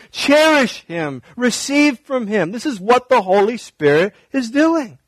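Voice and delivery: rising, earnest pulpit voice, slowing on the commands; fervent preaching. Acted. Pastor urging the church to draw near to God, fervent preaching. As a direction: press the truth home to the congregation.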